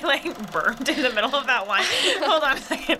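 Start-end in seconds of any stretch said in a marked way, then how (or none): none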